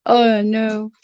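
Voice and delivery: normal talking voice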